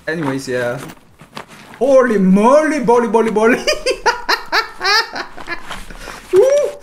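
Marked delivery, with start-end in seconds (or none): 1.8-6.7 high, loud giddy holy moly; bursting with glee after the kill; excited exclamation. Real, not acted. Streamer celebrates the kill, high giddy yelling. Hyped after winning a fight.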